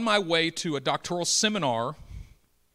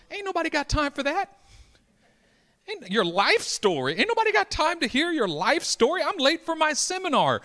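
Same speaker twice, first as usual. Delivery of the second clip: high-pitched comic protest; exasperated, squealing up high in disbelief; mock indignation; 6 dB louder than usual. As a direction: play up the incredulous impatience of someone running late. Acts out impatient inner reaction.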